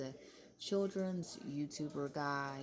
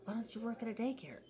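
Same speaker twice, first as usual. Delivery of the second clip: mock supporters; 6 semitones higher, faster than usual. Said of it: "her supporters to be like".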